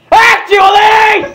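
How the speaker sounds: very high, slow, loud strained squeak; shy pony squeaking loudly; squeaky Fluttershy voice. Acted. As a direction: squeeze it out in a high throat-hurting squeal. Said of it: "a great idea for a voice".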